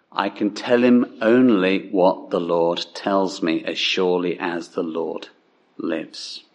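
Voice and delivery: low, grave prophet's pronouncement; ominous. Acted. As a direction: pitch it low and let it fade darkly. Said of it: "Well, that sounds fairly ominous".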